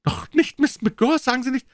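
high aghast denial; horrified plea. A narrator's reading. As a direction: beg it not be true. Prisoner horrified at accusation.

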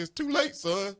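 high-pitched pronouncement as God; high divine verdict; God's refusal. Retold or imitated. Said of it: "God says".

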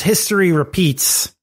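ordinary talk

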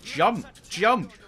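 loud urgent call; shouting commands. Real, not acted. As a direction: yell it across the room. Urging companion to jump.

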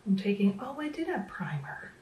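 ordinary talk

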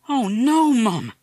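high, loud cry of dismay; boy shocked by bad news; boy crying out on hearing his parrot is gone. Boy hears parrot missing.

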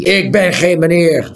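loud, high angry interruption; cutting in. A narrator's reading. Angry interruption. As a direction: owner cuts off Tiuri's polite address. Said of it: "viel de man hem in de rede".

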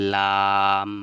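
লাম said loud, tongue tip touching the upper gum; Arabic letter lam. Shown as a demonstration. Teacher modelling লাম for students, loud and clear. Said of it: "তাহলে কী হলো?".

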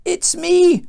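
high, loud moan; acted groaning prophet; groaned confession. A narrator's reading. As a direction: wail it out miserably. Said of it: "Jonah groaned".

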